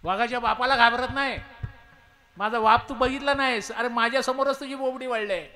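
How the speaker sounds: loud, high, taunting roar; mocking angry tiger. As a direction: throw his boast back in his face. Tiger mocks his boast.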